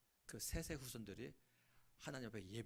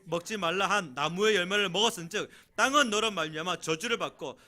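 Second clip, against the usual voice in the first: loud, high proclamation of scripture; solemn booming Bible reading; 7 semitones higher, 17 dB louder than usual. Acted. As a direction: read the verses like a herald. Reading scripture aloud to congregation.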